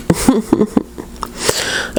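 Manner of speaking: soft breathy giggle, high; tired giggle. Real, not acted. Vlogger laughing at her own tiredness, soft giggle. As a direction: laugh it off, worn out.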